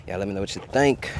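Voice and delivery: usual speaking voice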